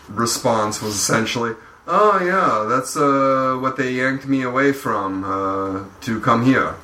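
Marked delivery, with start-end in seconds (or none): 1.9-6.7 light impression of an offhand reply; Tomas. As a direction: lightly imitate the artist's casual answer. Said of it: "That's a good impression".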